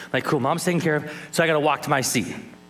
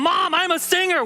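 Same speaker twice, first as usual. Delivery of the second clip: high, loud excited announcement; excited boy; 4 dB louder than usual. Retold or imitated. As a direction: burst out the news, thrilled. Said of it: "I ran home to tell my mom".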